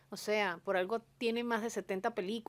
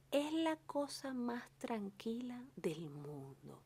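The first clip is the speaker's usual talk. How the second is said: slow, softer whisper for a very calm man; 9 dB quieter than usual. Retold or imitated. Describing calm Carlos Caridad, slow soft whisper. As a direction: hush it like someone you can barely hear. Showing how quiet Carlos is.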